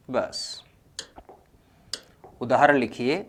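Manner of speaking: ordinary talk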